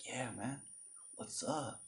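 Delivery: soft, near-whispered, too-cool greeting; cool hushed voice. Retold or imitated. Teen trying to impress someone, hushed and cool. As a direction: breathe it out, all cool. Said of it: "that voice, like".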